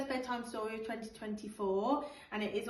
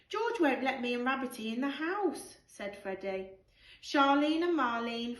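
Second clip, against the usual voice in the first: put-on lively storybook read-aloud for children; animated picture-book narration with character voices; bedtime story reading; 5 dB louder, slower than usual. Teacher reads bedtime story aloud.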